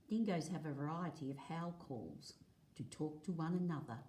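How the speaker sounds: clear, lively read-aloud for a class; reading to children. Acted. Teacher reading a dingo book to the class, lively read-aloud. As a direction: read the page out to the kids, a touch brighter.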